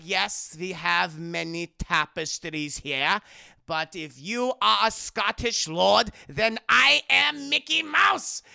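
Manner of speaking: slow, deliberate put-on butler; haughty butler. Butler seeing through the fake Scottish lord, slow haughty voice.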